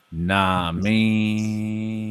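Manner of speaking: low, stretched "Nah, me"; slurred "know what I mean", dragged out; slow drawn-out catchphrase. Shown as a demonstration. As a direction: draw each word out long and slow. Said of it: "a slow one".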